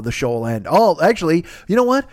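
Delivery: ordinary talk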